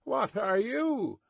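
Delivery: slow, high called question; drawn-out high challenge; guard's challenge. A narrator's reading. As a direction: demand it across the distance. Guard challenges the distant rider.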